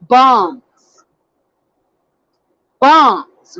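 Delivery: bombs set apart by a pause, then repeated; stress on bombs. Real, not acted. Host repeating her word from yesterday, stress on bombs. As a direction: land hard on the word bombs, letting it fall. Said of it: "I put emphasis on".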